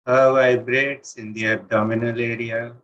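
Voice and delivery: normal talking voice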